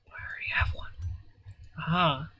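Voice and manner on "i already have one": breathy whispered aside; a quick private whisper. Real, not acted. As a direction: drop to a whisper under your breath. Muttering aside to himself.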